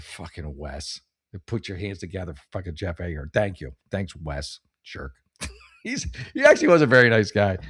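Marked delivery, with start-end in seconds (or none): 0.0-3.3 much softer, lower grumble under the breath; bitter mutter. Retold or imitated. Comic grumbling at the MC who ruined his set, low muttered. 3.3-5.1 much softer, lower sarcastic aside; deadpan jab under the breath; sarcastic thanks. Retold or imitated. Comic sarcastically thanking the MC, soft muttered jab.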